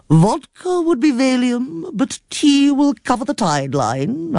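put-on slow, sing-song old aunt reciting; a lightly voiced aunt quoting a saying slowly. A narrator's reading. Aunt recites her saying to niece, slow and sing-song. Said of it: "Aunt Dizzy recited".

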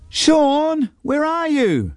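high far-off call for her brother; calling sister. A narrator's reading. Sister calls for Sean from the path.

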